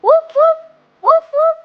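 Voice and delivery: high, loud paired woofs; dog bark. Acted. Leading children to bark like a dog, high woofs. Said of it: "woof like a dog".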